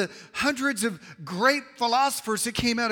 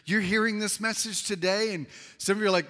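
normal talking voice

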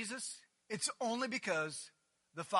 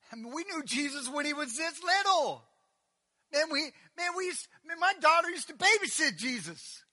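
indignant townsfolk; 8 semitones higher, 9 dB louder than usual. Retold or imitated. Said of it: "They said".

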